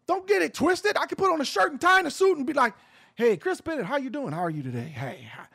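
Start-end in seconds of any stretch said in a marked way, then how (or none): 3.1-5.5 smooth, soft corporate charm fading low; butter up the host; buttery voice. Retold or imitated. Suit-and-tie voice greeting Chris Bennett, buttery smooth. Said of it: "I can put that buttery voice on".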